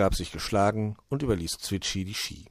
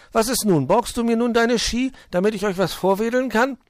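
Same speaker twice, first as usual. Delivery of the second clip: hohe, laute, drängende Vogelstimme; ungeduldiger kleiner Vogel; 14 semitones higher, 7 dB louder than usual. Acted. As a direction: spiel den kleinen Vogel, hoch und fordernd. Voices Zwitschi, eager to borrow skis. (ungeduldig drängelnder kleiner Vogel)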